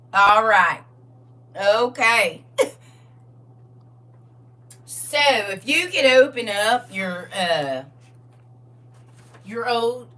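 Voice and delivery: loud, hammed-up Southern drawl; country Southern accent. Teacher waiting on students to get ready, country Southern accent. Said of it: "if you talk like that".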